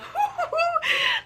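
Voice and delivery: shrill burst of laughter; high squealing laugh. Real, not acted. Telling viewers about the Orc Prince, squealing laugh.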